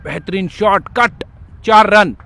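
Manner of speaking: loud, rising boundary call; excited cricket commentary. Acted. Live play-by-play of a boundary.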